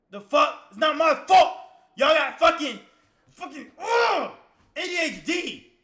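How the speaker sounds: loud, high furious rant; crashing out. Real, not acted. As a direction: rant at chat, yelling. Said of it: "crashing out".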